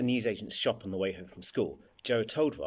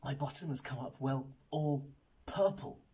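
reluctant confession; 5 semitones higher, 4 dB quieter than usual. A narrator's reading. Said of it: "Raj took a deep breath".

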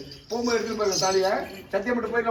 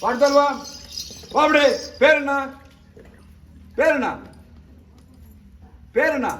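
loud, raised, insistent demand; commanding summons; 4 semitones higher, 5 dB louder than usual. Real, not acted. Exorcist summoning a spirit to name itself, loud commanding voice. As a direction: call it out and demand an answer.

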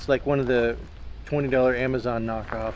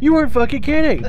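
amazed; 12 semitones higher, 10 dB louder than usual. Real, not acted. Reacting to cold room.

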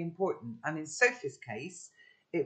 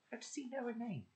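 hushed; 8 semitones higher, 10 dB quieter, faster than usual. A narrator's reading. Narrator's hushed inner thought.

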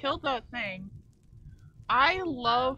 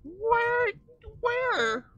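calling out; 12 semitones higher, 8 dB louder than usual. Real, not acted. Calling out for a lost friend.

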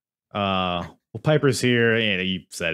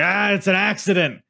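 Piper insult; 8 semitones higher, 4 dB louder than usual. Retold or imitated. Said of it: "Piper was like".

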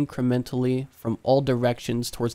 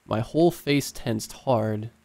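plain reading voice

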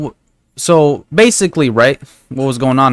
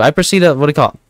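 normal talking voice

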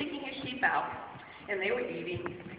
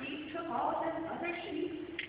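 ordinary delivery, nothing marked

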